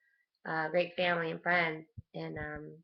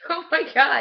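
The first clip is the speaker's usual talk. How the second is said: higher, louder laughing exclaim; laughing oh my God; 8 semitones higher, 10 dB louder than usual. Retold or imitated. Reacting to dad's joke, laughing loudly. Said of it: "I'm like".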